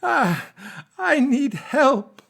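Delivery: haunting, very high, drawn-out plea; slow falsetto damsel, hammed-up and desperate; Mary Steenburgen. Playing a woman begging Steven for help, haunting high voice. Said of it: "the most haunting, beautiful voice".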